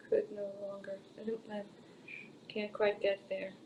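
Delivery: normal talking voice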